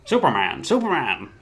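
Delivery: hammy golden-age radio reporter; old radio drama voice. Acted. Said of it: "Like those old radio drama".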